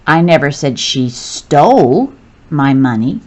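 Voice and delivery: "stole" stretched with a pitch swoop, then a pause; stress on stole. Shown as a demonstration. Teacher demonstrating emphasis to learners, stress on "stole". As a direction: make "stole" the word that matters. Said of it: "When I emphasize the italicized word".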